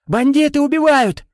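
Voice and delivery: high, loud desperate scream. Acted. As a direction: scream at the top of your lungs. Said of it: "Надрывался Паша".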